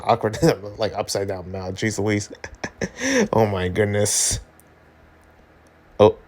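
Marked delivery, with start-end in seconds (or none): none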